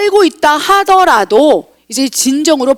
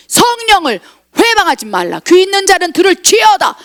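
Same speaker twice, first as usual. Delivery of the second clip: scriptural warning; 6 semitones higher than usual. Acted. Proclaims scripture as divine warning.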